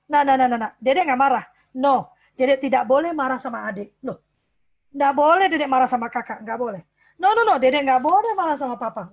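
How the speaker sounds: put-on loud, insistent no-no-no to a little child; mother mock-scolding a small child for being angry; strict parent scolding. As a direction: wag the finger at the toddler, loudly. Parent forbidding child's anger.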